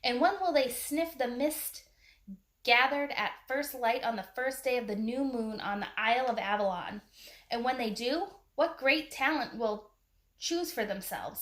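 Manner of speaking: ordinary delivery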